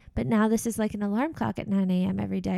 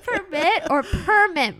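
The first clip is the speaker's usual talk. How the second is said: two takes of PERMIT, stressed differently, slow and louder; contrasted stress on permit; 6 semitones higher, 5 dB louder, slower than usual. Shown as a demonstration. Daughter showing mom the two differ, PERMIT contrasted slowly and loudly. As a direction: set one PERMIT against the other, higher and deliberate. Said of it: "you just said the same thing twice".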